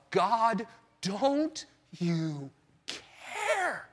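soft, very slow, broken plea to God; desperate prayer. Retold or imitated. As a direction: ask it barely above a breath, drawn out. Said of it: "I remember thinking to myself".

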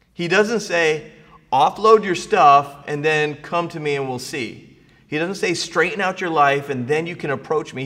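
fervent preaching. Acted. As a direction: push each line to the back row. Loud preaching of God's invitation.

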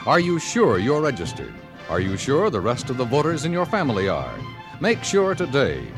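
ordinary talk, nothing marked

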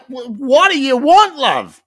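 high, loud, slow what do you want love; incredulous. Retold or imitated. Host retelling his reply to the servo cashier, incredulous. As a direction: push back in disbelief. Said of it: "I'm like".

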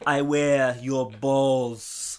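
loud, drawn-out action-hero boast; heavy, slow Terminator-style threat; Schwarzenegger. Acted. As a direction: boom each word very slowly. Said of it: "you Arnold Schwarzenegger".

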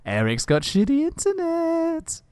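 high, slow playground taunt; teasing singsong. Pretend. Host teases Eric about connection, high singsong. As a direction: draw it out like a teasing chant.

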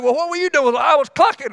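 lightly hammed chicken chat; mock chicken. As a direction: play a gossiping hen. Chickens voiced talking.

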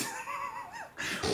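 high stifled burst of laughter. Real, not acted. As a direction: fails to hold in a laugh. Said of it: "trying to hod".

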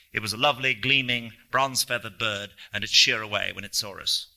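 plain reading voice

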